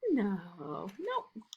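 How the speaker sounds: usual speaking voice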